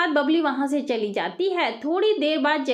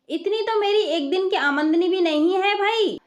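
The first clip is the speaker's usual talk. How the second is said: husband outraged at the bill; a disbelieving cry about the money; shocked protest; 5 semitones higher than usual. Acted. Jugnu shocked at hundred rupees.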